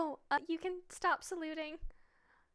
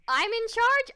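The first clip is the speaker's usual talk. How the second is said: rising in disbelief; loud, high shocked question; surprised question; 6 semitones higher, 10 dB louder than usual. Real, not acted. Told she is in charge.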